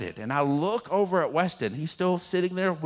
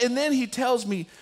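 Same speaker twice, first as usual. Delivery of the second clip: ordinary talk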